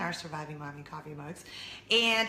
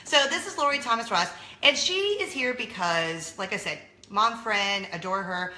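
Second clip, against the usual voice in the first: ordinary talk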